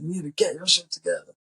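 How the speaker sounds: high, loud put-on telling-off; imitated movie character. Character lecturing his friend, imitated scolding voice. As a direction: push it up into a character voice. Keaty's advice acted out.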